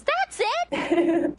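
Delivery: high, loud imitated yell; Lucy, yelling. Host acting out the girl yelling when she hears the right tune, high yell. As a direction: burst out in a high mock shout. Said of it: "and she yells".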